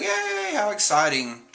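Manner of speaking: very high yay, then back down; a crowd's sudden delighted yay; cartoonish cheer. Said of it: "everybody's like".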